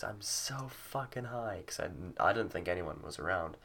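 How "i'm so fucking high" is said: high, drawn-out mock yell kept soft; imitated yell. Retelling a yell out in the street, hushed mock yell. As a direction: stretch it out like a yell, volume held back. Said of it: "I yelled at the top of my lungs".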